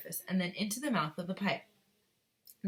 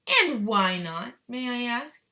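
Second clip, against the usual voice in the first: sharp demand; 5 semitones higher, 8 dB louder, slower than usual. Acted. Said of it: "shouted Missus Gloop".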